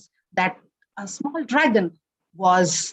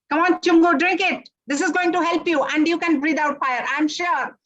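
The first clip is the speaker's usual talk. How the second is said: higher, eager coaxing voice; coaxing witch; 6 semitones higher than usual. A narrator's reading. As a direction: urge him to drink up.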